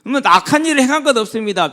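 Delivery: loud, high-pitched sermon delivery; forceful preaching. Real, not acted.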